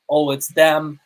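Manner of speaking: normal talking voice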